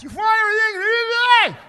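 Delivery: shouting high and loud through plexiglass; muffled shout through a barrier; cashier yelling through glass. Retold or imitated. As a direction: yell it through thick glass with a mask on. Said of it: "trying to yell through the glass".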